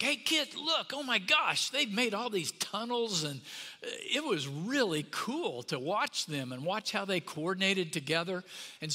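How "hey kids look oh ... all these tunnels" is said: put-on much higher, bright wonder calling the kids; oh-my-gosh amazement at the tunnels; excited discovery. Dad waking the kids to show the ant tunnels, excited.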